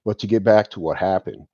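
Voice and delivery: usual speaking voice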